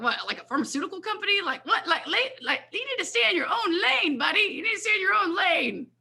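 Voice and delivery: high, laughing scoff at a silly idea; scoffing dismissal. Retold or imitated. She laughs off her husband's suggestion, high scoffing.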